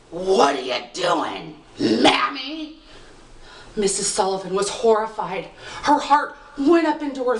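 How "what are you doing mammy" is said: put-on deep, slow, creaky old man's voice from a baby's cradle. Changeling fairy asks its mother in an old man's voice. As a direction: croak it like an ancient man pretending to be a child. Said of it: "in the voice of an old man".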